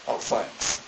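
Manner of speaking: breathy, sarcastic under-the-breath thanks; grudging teen mumble; sulky mutter. Retold or imitated. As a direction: mutter it sourly. Daughter's sulky reply.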